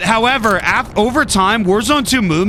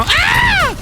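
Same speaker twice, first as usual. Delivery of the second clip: sudden high yelp, cut off; startled yelp. Real, not acted. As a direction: jump as the shot hits. Gets shot mid-sentence.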